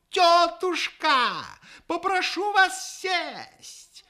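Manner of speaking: very high, slow clown patter; loud showman shouting over a crowd; booming circus ringmaster. Acted. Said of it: "крикнул хозяин".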